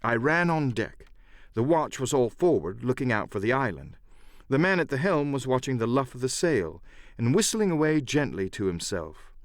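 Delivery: plain reading voice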